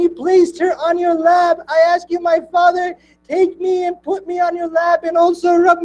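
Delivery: high-pitched, impassioned mourning preaching; lamenting sermon recital. Acted. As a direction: raise the voice to a grieving wail as the story peaks.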